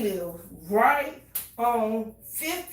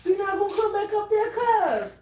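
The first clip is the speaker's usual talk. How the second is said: imitated pleading cousin; 10 semitones higher, 4 dB louder than usual. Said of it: "And they said".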